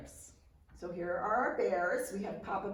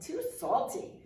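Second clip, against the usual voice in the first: Goldilocks voice; 8 semitones higher than usual. A narrator's reading. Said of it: "and she went".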